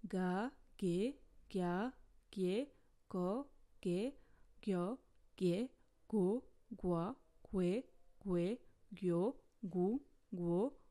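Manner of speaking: each Korean syllable said distinctly, rising pairs; modelled vowel syllables. Shown as a demonstration. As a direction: drill the vowels for the class. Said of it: "Voici les voyelles".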